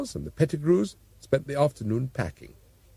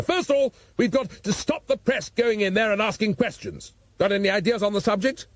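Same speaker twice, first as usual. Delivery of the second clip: raised, blustering police chief; gruff Commissioner; 9 semitones higher than usual. A narrator's reading. Commissioner scoffing at his assistant, blustering. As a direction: bluster like an exasperated top cop.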